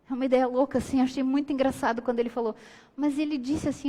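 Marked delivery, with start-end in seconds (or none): none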